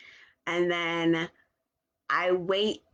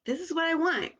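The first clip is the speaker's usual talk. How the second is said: put-on child voice; 8 semitones higher than usual. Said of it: "I mean, like, really".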